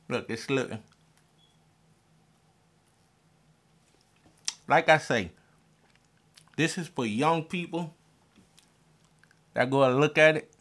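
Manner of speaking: speaking with tooth grill in; slightly slurred through grill; grill on teeth. Real, not acted. Seen 3: Silver grill on teeth throughout; inserted near -1, removed after.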